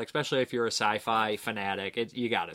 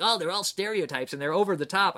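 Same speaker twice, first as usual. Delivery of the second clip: higher, scornful mock voice; 7 semitones higher than usual. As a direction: parody the smug trope. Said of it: "that trope that came over and was like".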